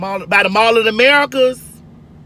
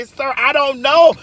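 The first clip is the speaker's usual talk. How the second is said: faux-innocent reply; 6 semitones higher, 4 dB louder, slower than usual. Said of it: "I say".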